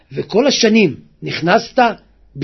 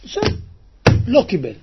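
ordinary talk

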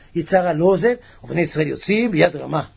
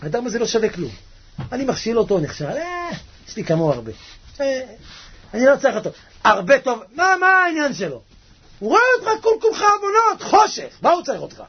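high, mocking dismissive voice; the evil inclination scorning a sinner, pitched up; 7 semitones higher than usual. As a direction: sneer like the tempter who doesn't care. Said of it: "יצר הרע אומר".

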